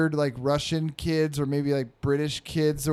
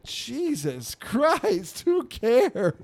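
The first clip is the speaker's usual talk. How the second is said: exasperated onlookers; 8 semitones higher, much slower than usual. Retold or imitated. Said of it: "we're just like".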